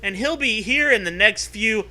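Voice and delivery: high, loud, frantic mom voice; panicked mom. Acted. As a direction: rush it like a panicking parent. Panicked parent voice.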